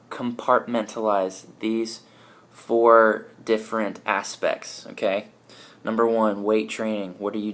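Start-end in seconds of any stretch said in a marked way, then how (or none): 0.1-4.9 slow and deliberate. Real, not acted. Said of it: "I want you to".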